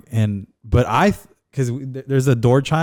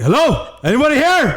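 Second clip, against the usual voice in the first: high startled call through the house. Retold or imitated. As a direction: shout it like you think someone broke in. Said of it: "He walked out of her room saying".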